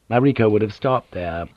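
ordinary delivery

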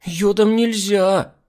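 put-on high, loud whiny girl; capricious child. A narrator's reading. Spoiled girl refuses iodine, high loud whining. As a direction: whine like a spoiled kid. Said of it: "капризничала Миранда".

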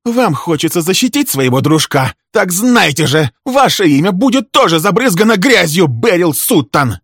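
high, loud venomous tirade; enraged woman shouting; furious threat. Acted. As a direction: rail at your rival, drawing out the threat.

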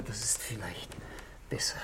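unvoiced whisper, trailing off; whispered aside. Nervous husband to the inspector, in a whisper. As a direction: breathe it so nobody overhears. Nervous man lowers his voice.

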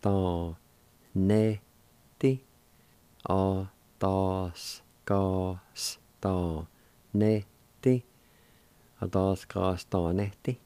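"Tá, né, tí" and "Á, dás, gás" said one syllable at a time; teacher drilling "Á, dás, gás, tá, né, tí" syllable by syllable. Shown as a demonstration. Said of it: "It's pronounced like this".

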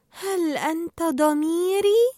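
slow, high, drawn-out question; wondering Dory. A narrator's reading. Dory takes Marlin for her conscience, slow awed question.

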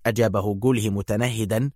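ordinary delivery, nothing marked

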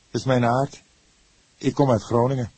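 lightly voiced young man. A narrator's reading. Young man Erik answers the cook, lightly voiced.

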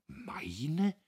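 erschrockenes, tonloses Flüstern; gestottert. A narrator's reading. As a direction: bringt das Wort kaum heraus. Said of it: "stotterte Diederich". (Diederich stottert erschrocken nach)

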